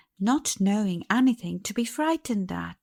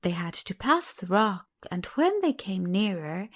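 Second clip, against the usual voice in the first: plain reading voice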